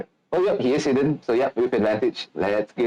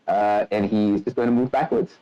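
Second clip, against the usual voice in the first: usual speaking voice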